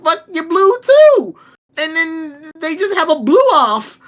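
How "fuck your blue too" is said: mock retort. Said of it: "And it's like".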